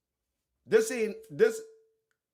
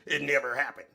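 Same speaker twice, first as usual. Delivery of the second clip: low, mock-solemn lawyer voice; 9 semitones lower than usual. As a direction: parrot the lawyer in a low pompous tone. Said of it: "Look at this stupid ass lawyer".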